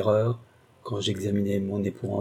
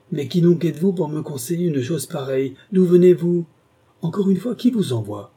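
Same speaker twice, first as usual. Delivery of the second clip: rising, louder, higher angry questions; hateful outburst; 6 semitones higher, 5 dB louder than usual. A narrator's reading. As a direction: fling the questions at him in hatred. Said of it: "avec une expression de haine indicible".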